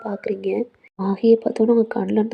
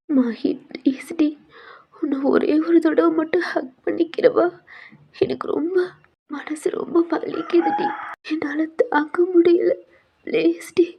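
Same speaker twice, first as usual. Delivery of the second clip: tearful begging; 6 semitones higher than usual. Acted. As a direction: sob out the request for a hug. Heroine asks friend for hug. (அழுகையுடன் கெஞ்சும் குரல்)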